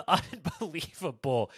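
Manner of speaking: slow, laughing through the word. Real, not acted. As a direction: laugh it out in disbelief. Repeats word, second laughing.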